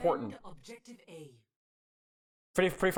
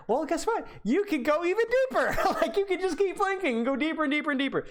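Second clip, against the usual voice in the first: excited; 12 semitones higher than usual. Real, not acted. Coach gets excited about flanking.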